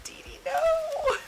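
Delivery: very high, slow whining plea; mock whimper. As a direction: whimper it like a hurt kid. Retelling protest to Dee Dee.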